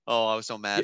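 ordinary talk, nothing marked

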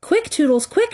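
high, urgent mock scream; shrill urging, held well short of screaming. A narrator's reading. Tink urges Tootles to shoot, mildly screamed. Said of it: "she screamed".

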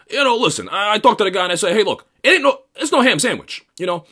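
high, loud New York sales guy rant; mocking a Metro North sales dude. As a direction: play a schmoozing sales bro swapping war stories. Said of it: "They're just like".

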